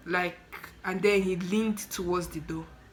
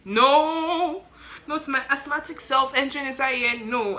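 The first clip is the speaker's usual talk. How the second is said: high, loud, drawn-out protest; vehement refusal; 7 semitones higher, 6 dB louder than usual. Retold or imitated. Asthmatic visitor refusing to go inside, vehement. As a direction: refuse point-blank, voice shooting up.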